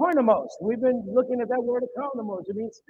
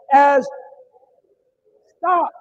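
sharp stop; 3 semitones higher, 9 dB louder than usual. Real, not acted. Halts congregation's reading.

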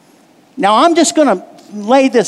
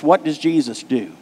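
normal talking voice

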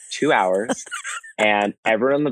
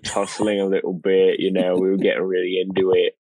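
put-on grungy British pub talk; grungy British accent; 3 semitones lower than usual. Shown as a demonstration. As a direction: fake a British lad telling a pub story. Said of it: "You got a bit more grungy".

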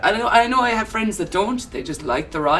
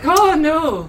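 coy refusal; 9 semitones higher, 4 dB louder than usual. Retold or imitated. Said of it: "to be like".

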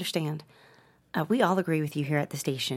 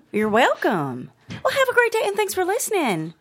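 much higher and louder sing-song customer service; overly chipper sign-off; 8 semitones higher, 8 dB louder than usual. Acted. Receptionist brightly getting rid of caller, fake cheer.

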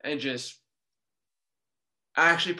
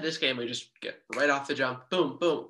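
ordinary talk

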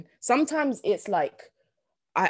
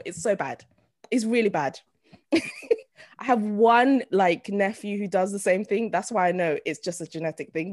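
ordinary delivery

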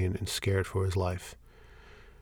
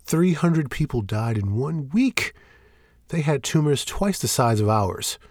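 slow, weighted outrage, higher; protesting; 5 semitones higher, 4 dB louder, slower than usual. Acted. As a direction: hammer each grim fact home. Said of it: "Shane protests".

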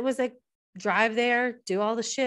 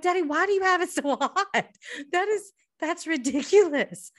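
exasperated; 9 semitones higher, 3 dB louder than usual. Retold or imitated. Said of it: "I'm like".

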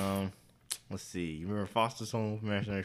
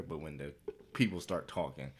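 ordinary talk, nothing marked

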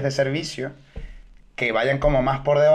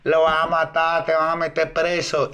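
mock workers yelling; much slower than usual. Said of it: "Y empiezan".

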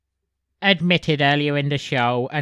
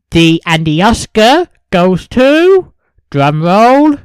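award announcer; 2 semitones higher, 11 dB louder than usual. Acted.

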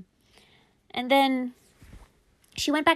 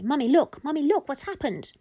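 put-on higher, mildly urgent kid voice; shocked children; 5 semitones higher than usual. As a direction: get mum's attention like a startled child. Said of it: "his sister was shocked as well".

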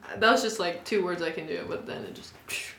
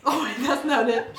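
normal talking voice

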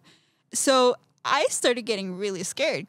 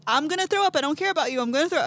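much higher and louder, defiant; defiant outburst; 6 semitones higher, 3 dB louder, much faster than usual. Retold or imitated. Defying the voice in her head, high and loud. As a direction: shout down the voice. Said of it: "And I was like".

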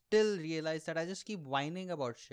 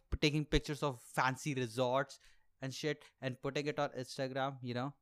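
normal talking voice

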